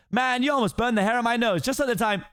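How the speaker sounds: high fast-talking donkey voice; excited Donkey. Acted. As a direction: gush admiringly at the ogre. Donkey chatters on.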